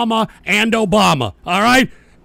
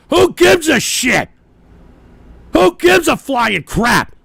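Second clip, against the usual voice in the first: slow angry rant; 4 semitones higher, 4 dB louder, slower than usual. Real, not acted.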